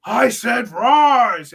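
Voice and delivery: Damian Priest shout. Retold or imitated. Fan imitating Damian Priest demanding the crowd rise, slow mock shout. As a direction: drag out the order like a judge. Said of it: "He's got that deep voice".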